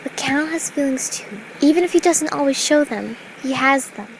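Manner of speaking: gentle Fluttershy. A narrator's reading. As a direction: explain kindly to a friend. Narrator voices Fluttershy.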